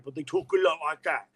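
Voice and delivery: intense, marble-mouthed Salisbury English; Salisbury accent. Retold or imitated. Describing how Salisbury people talk, intense marble-mouthed Salisbury accent. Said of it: "he's from Salisbury".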